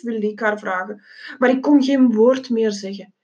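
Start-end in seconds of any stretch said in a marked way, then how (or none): none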